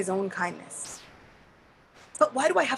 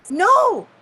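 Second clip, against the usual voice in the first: emphatic no; 9 semitones higher, 8 dB louder than usual. Acted. Indra denying he is a devotee.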